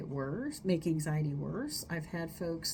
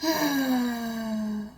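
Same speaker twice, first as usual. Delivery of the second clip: loud vocal sigh on ah; 5 semitones higher, 6 dB louder than usual. Shown as a demonstration. Therapist demonstrating the sighing breath, loud vocal sigh. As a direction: push out a big voiced ah. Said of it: "like ah".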